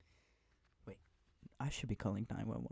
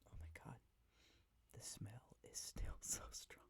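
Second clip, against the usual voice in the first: whispering; 11 dB quieter than usual. Real, not acted. ASMR whisper video.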